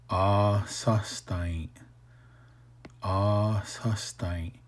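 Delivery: "A sustain" drawn out slowly, each syllable clear; slow demo. Shown as a demonstration. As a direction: stretch "A sustain" out slowly for learners. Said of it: "You might cry or you should cry".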